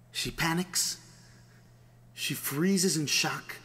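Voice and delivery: plain reading voice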